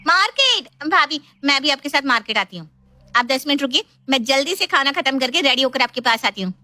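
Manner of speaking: high, excited pipe-up; eager young sister-in-law. Acted. Sister-in-law begging to join bhabhi at market, eager and high. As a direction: pitch it up like an eager tag-along.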